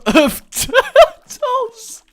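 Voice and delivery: slow words broken by laughter; laughing. Real, not acted. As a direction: giggle through the phrase. Amused by absurd item combo.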